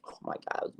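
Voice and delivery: breathless whispered oh my God; amazed at the sideline catch, whispered; awed whisper. Real, not acted. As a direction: gasp it out in disbelief.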